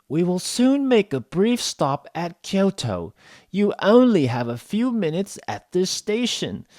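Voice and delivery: high, slow announcer with special pronunciation; train announcement. Acted. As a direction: announce slowly in a high, odd mixed Canadian-British accent. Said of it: "a special pronunciation".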